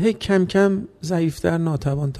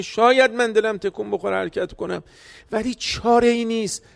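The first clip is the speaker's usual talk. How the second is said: usual speaking voice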